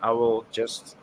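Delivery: ordinary talk, nothing marked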